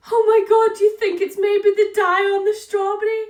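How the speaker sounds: high, loud, panicked wail. Retold or imitated. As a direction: cry it out in a rising panic. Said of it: "I was like".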